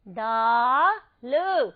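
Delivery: very slow, drawn-out दाल; stretched model word. Shown as a demonstration. Teacher showing the picture, draws out दाल. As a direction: hold दाल long and clear. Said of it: "ये है".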